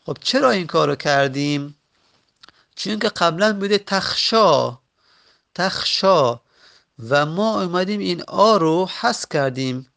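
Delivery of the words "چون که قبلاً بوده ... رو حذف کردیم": ordinary delivery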